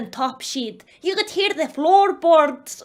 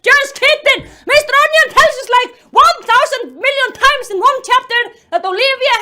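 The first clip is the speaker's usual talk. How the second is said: high, loud exasperated outburst; exasperated rant; 7 semitones higher, 6 dB louder than usual. Real, not acted. Reader mocks Mister Onion's repetition, exasperated. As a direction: burst out loudly, pitch way up.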